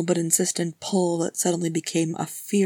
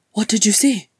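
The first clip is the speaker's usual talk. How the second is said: louder, higher anxious question; 4 semitones higher, 7 dB louder than usual. Acted. Legolas questions Gimli after the spell, anxious. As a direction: press for an answer with worry. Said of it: "Legolas asked anxiously".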